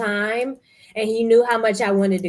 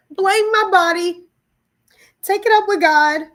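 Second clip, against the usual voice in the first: exasperated outburst; 8 semitones higher, 6 dB louder than usual. Real, not acted. Frustrated outburst.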